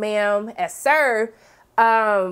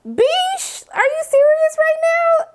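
very high, squealed disbelief; incredulous; 4 dB louder, slower than usual. Real, not acted. Reacting to Fred claiming he never harmed her, incredulous. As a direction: pitch it way up, outraged.

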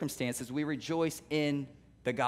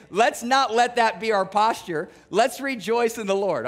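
slow, higher and louder preaching; preaching fervor; 5 semitones higher, 6 dB louder than usual. Acted. Pastor preaching Paul's call to the church, fervent. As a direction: urge the church to rejoice.

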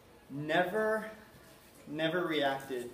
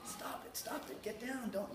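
whispered mock yelling; silent yelling; 8 semitones higher, 9 dB quieter than usual. Dad at a party scolding his son in a whisper. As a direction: yell at the child in a whisper. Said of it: "yelling at my son".

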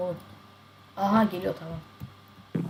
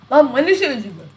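excited outburst; 5 semitones higher, 8 dB louder than usual. Real, not acted.

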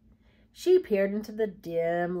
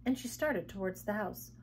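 ordinary delivery, nothing marked

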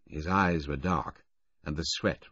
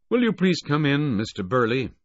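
higher, louder call through the door; 10 semitones higher, 7 dB louder than usual. A narrator's reading. Bill Baudelaire summoning the conductor, louder call. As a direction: summon him in firmly.

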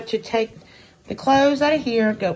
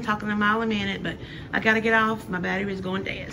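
fast, hurried talking; pressured rushing speech; faster than usual. Real, not acted. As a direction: rush through it to sign off. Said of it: "I'm so sorry for babbling".

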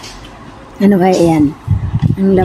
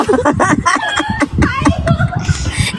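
squealing laugh; high laugh; 4 dB quieter than usual. Real, not acted. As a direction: burst into shrill laughter. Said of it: "Tawa pa". (matinis na tawa)

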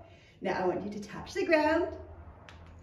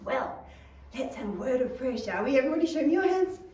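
ordinary talk, nothing marked

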